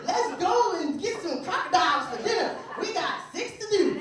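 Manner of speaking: high, eager backwoods voice; hillbilly. Acted. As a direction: urge the hunt on as a cartoon hillbilly. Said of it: "You can get these hillbillies".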